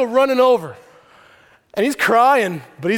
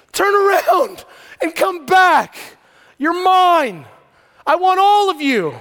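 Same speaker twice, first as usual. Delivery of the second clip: a touch of pleading; 7 semitones higher, 4 dB louder, slower than usual. Retold or imitated. God calling back.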